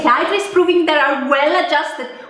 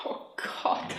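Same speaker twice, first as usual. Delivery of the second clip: whispered groan; 16 dB quieter than usual. Acted.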